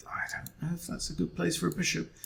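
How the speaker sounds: whispered aside to himself. Real, not acted. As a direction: whisper it under your breath. Muttered aside while thinking.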